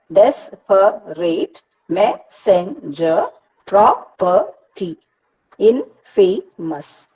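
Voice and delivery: "Desperate, messenger, property, infamous" slowly broken into syllables. Shown as a demonstration. Teacher showing how to divide words, "Desperate, messenger, property, infamous" in separate syllables. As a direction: give each syllable of "Desperate, messenger, property, infamous" its own slow beat. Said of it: "This is how you divide the words".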